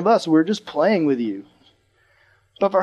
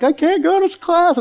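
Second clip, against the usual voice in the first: high, drawn-out whine of dread; nervous kid; 13 semitones higher, 3 dB louder than usual. Retold or imitated. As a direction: act out a panicky kid, much higher. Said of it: "I'd be so nervous".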